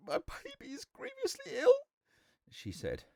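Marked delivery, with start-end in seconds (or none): 0.1-1.7 put-on very high, tearful young woman; sobbing. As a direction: plead through heartbroken sobs. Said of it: "sobbing as though her heart would break".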